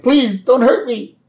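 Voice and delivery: high, hammed-up frightened plea; mock pleading. Homer begs the glowing alien, high mock-scared voice. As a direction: beg like a scared cartoon Homer. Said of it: "And Homer's like".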